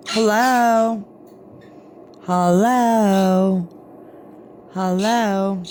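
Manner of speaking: long, stretched "hello"; drawn-out prompt. Shown as a demonstration. Owner teaches parrot to say hello, drawn-out. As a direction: model the word slowly for a parrot.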